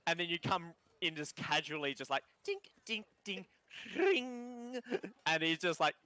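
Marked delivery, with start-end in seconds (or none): 2.5-4.8 high, ringing plucked-string noises; imitated lute plinks.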